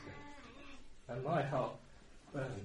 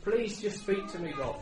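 pleading prayer; 7 semitones higher, 7 dB louder than usual. Retold or imitated. Retold desperate prayer to God.

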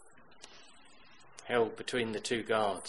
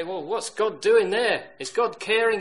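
rhetorical doubter; 9 semitones higher, 10 dB louder than usual. Acted. Imagined doubter, then preacher's answer.

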